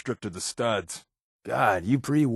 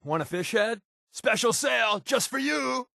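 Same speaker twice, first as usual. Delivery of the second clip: high shouted sales pitch; hammed-up market hawker yelling to a customer; mock street vendor; 11 semitones higher than usual. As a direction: hawk it like a pushy fishmonger.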